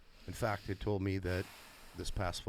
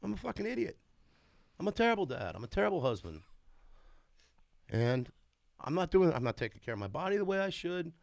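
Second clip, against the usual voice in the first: raised, self-berating inner voice; self-critical inner voice; 8 semitones higher, 3 dB louder than usual. Retold or imitated. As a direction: beat yourself up inside your head, pitched higher. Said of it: "I'm thinking in the back of my head".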